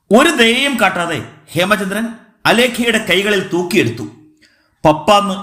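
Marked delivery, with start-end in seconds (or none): none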